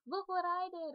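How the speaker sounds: an eager little son shouting for his dad; excited small child's cry; little boy calling. Pretend. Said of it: "bring out and be like".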